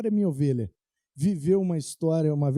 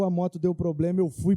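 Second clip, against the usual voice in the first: ordinary delivery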